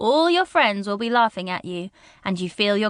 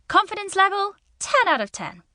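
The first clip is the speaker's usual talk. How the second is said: confident; 9 semitones higher than usual. Acted. Confidence high after fun practice.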